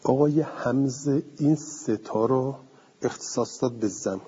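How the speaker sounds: usual speaking voice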